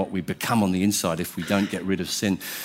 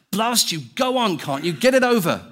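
a contained bellow, pitched up; 9 semitones higher than usual. A narrator's reading. Ghost giving in to the angel, bellowing. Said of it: "bellowed the ghost".

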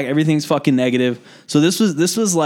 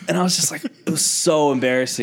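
ordinary delivery

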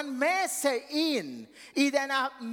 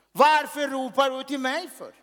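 loud, high call from above; loud divine question; God calling out; 4 semitones higher, 7 dB louder than usual. Acted. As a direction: boom it like God asking Moses. Preacher voices God calling out.

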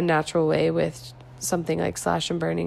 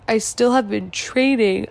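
normal talking voice

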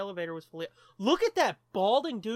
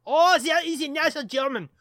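put-on German accent; 7 semitones higher, 9 dB louder than usual. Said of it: "Is it still okay to do a German accent?".

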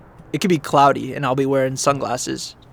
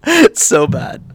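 high and louder through a laugh; 9 semitones higher, 6 dB louder, slower than usual. Real, not acted. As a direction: laugh it out, embarrassed at the stalling. Laughs at own stalling.

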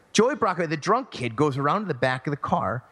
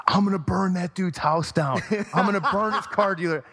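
mock threat; 6 semitones higher than usual. Drunk Joey's threatening voicemails.

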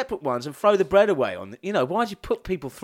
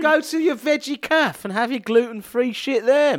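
mock shout; 7 semitones higher than usual.

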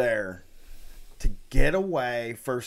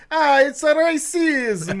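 hammed-up Italian accent, pitched much higher and louder; mock Italian accent; 6 dB louder than usual. Co-host riffs on the made-up word in Italian accent. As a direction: throw in a big Italian flourish. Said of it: "Italian accent without the pizzazz".

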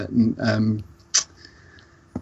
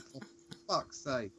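muttered exasperation; 16 dB quieter, slower than usual. Retold or imitated. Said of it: "And you just go like".

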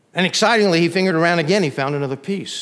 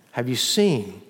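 ordinary talk, nothing marked

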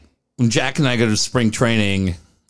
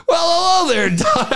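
put-on old man greeting. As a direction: call it out like the cheerful old-timer.

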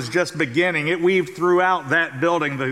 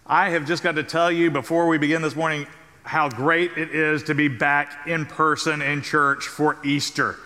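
normal talking voice

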